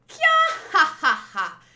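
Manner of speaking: loud, high mocking cackle; shrill villainess cackle. Villainess laughing at her foes, a loud high cackle.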